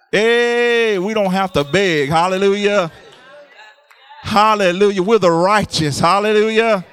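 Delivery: loud, high, fervent preaching; preaching at full force. Acted. Preacher rousing the congregation with full-force shouted hallelujahs. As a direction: build the congregation up, shouting praise.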